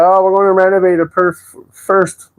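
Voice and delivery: high put-on voice, a lightly mocking landlord. Renter mimics landlords cancelling his place last minute, high mock voice. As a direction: parrot the landlord's breezy excuse up high. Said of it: "Why am I talking like this?".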